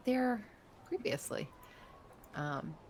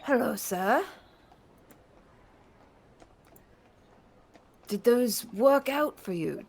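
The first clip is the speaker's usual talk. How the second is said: put-on much higher, fluttery welcome in an elderly voice; older Dragonborn shopkeeper welcomes the party into his shop; 7 semitones higher, 7 dB louder than usual. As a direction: greet them like a doting old merchant. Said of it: "older Dragonborn".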